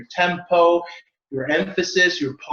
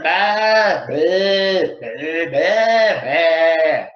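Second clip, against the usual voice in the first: louder mock sheep bleats; sheep bleating; 5 dB louder, much slower than usual. Shown as a demonstration. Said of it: "a flock of sheeps".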